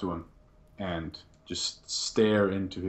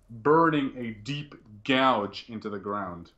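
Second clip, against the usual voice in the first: ordinary talk, nothing marked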